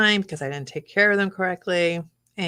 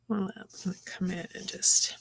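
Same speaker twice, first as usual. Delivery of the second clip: whispering; 7 dB quieter than usual. Real, not acted. Painting quietly, whispering to work.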